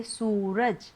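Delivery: सूरज slowly, with a drawn-out "sooo"; long oo. Shown as a demonstration. Teacher gives an example of the long vowel, drawn out. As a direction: stretch the first vowel of सूरज. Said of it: "ऊ की थोड़ी सी लंबी खींचती है".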